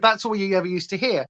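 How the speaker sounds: ordinary talk, nothing marked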